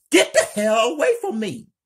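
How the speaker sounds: high, loud, fast frightened scream; scared. Acted. Kelly terrified of Lena, fast high scream. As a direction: shriek it in panic, backing away.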